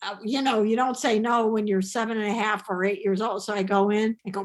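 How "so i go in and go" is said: ordinary delivery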